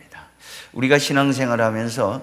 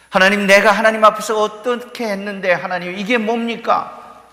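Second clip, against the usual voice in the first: higher, indignant mock protest; protesting king; 7 semitones higher, 3 dB louder than usual. Imagined king protesting to God, higher and indignant. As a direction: throw the question at God, aggrieved. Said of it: "그는 항의하지 않았어요".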